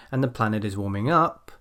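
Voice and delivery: "up" swept upward at the end; rising intonation. Shown as a demonstration. Teacher shows the end of a dependent clause, rising on "up". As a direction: start low and lift "up", unfinished. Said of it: "my voice goes up a bit".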